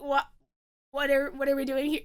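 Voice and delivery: low, soft, halting whisper-like aside; hushed mock confusion. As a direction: imitate puzzled guests under the breath. Hushed uncomfortable guests acted.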